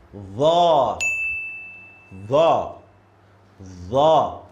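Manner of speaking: 'The' with tongue to front teeth, loud and drawn out; tongue between the teeth, say 'The' clearly; Arabic letter Tha. Shown as a demonstration. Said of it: "this is the third letter".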